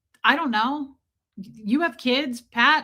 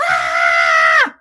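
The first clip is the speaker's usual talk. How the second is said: put-on a sudden low, loud shout like a kid charging past; kid's yell; 11 dB louder than usual. A kid running past her, letting out a loud yell. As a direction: bellow out one wild kid scream.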